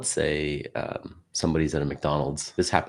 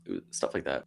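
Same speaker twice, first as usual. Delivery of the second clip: ordinary talk, nothing marked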